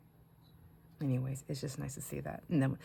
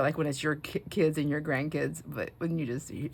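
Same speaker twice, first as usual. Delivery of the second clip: ordinary talk, nothing marked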